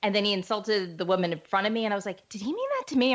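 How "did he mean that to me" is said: much higher, hopeful squeak of a question; higher, eager fan asking her husband. Retold or imitated. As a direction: ask it like a fan desperate to be picked. Hoping to be insulted by Rickles.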